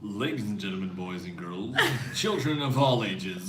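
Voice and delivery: low, grand circus announcer; ringmaster voice. Shown as a demonstration. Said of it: "That's so good".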